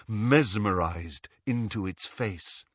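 ordinary delivery

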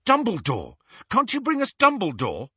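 high, loud eager plea; sudden inspiration. A narrator's reading. Harry struck by an idea, asking the portrait excitedly. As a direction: blurt it as the idea strikes. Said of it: "had a sudden inspiration".

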